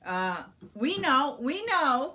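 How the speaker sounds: flustered cry. A narrator's reading. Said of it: "cried those two sisters".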